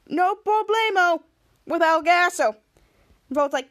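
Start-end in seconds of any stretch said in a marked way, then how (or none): 0.1-2.6 mock-Spanish flourish. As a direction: draw out the mock Spanish playfully. Character jokes in mock Spanish.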